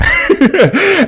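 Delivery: loud high burst of laughter. Real, not acted. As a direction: let a real laugh burst out. Laughs at election night memory.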